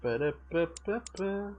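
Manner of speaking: ordinary talk, nothing marked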